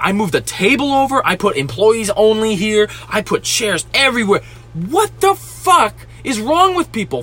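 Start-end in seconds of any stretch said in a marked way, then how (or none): 4.7-7.2 high, drawn-out angry question; exasperated outrage. Real, not acted. Venting about rude customers, exasperated outrage. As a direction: pitch it way up in disbelief, stretched out.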